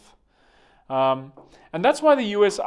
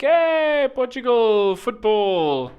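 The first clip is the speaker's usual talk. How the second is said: cheerful fan shout; 8 semitones higher, 5 dB louder, much slower than usual. Pretend.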